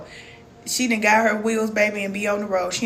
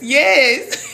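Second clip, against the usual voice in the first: delighted laugh; 6 semitones higher, 4 dB louder than usual. Real, not acted. Delighted by viewer's comment.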